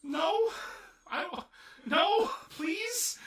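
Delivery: high, loud begging in comic dread; hammed-up desperate pleading; mock pleading. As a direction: plead as if about to be hurt. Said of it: "I was like".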